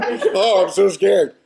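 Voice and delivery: laughing mock fright; mock scared. Actor mocking the smiley-face monster, laughing. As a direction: pretend to be terrified, laughing.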